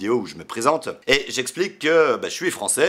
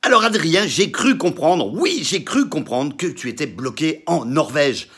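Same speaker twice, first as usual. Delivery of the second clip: loud, high greeting-video delivery; emphatic announcing; 4 semitones higher, 4 dB louder than usual. Acted. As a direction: address the fan with big, bouncing emphasis. Performed fan video message. (adresse emphatique, forte et aiguë)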